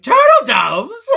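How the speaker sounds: high, loud, drawn-out mock shout; hammed-up kingly outburst, half laughing; excited king. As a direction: bellow it as an amazed question. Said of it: "the king is like".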